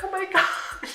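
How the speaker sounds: high, shocked little gasp of a line; a frightened child seeing blood, with a laugh; mock-panicked kid voice, half laughing; scared kid. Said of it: "And I was like".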